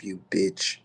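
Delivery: ordinary talk, nothing marked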